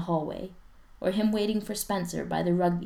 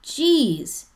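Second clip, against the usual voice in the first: exasperated; 9 semitones higher, 9 dB louder than usual. Acted. Spencer exasperated after yelling.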